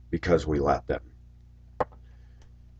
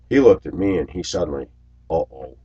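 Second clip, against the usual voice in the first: ordinary talk, nothing marked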